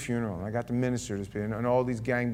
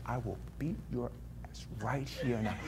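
whispered, softer threat; menacing whisper; 9 dB quieter than usual. Retold or imitated. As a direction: hiss it close to his face. Threatening Danny against wall.

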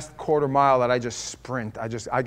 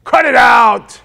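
high, loud barking shout; mock drill-sergeant scolding; tough-love yell; 9 semitones higher, 15 dB louder, slower than usual. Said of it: "And not just".

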